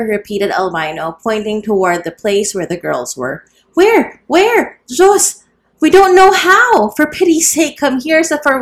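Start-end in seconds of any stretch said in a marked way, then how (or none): 3.7-5.4 very high, loud frightened calls; panicked cries. A narrator's reading. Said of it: "begged the frightened women". 5.8-6.9 very high, loud, desperate wail; panicked shout. A narrator's reading. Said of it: "begged the frightened women". 7.0-8.6 frightened begging. A narrator's reading. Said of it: "begged the frightened women".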